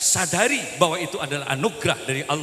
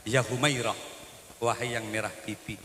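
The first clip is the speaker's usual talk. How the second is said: soft, lower and quieter; tender address; 6 semitones lower, 5 dB quieter than usual. Retold or imitated. The Prophet softly calling his wife, tender and quiet. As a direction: coax her gently, like a loving husband. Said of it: "Santun loh".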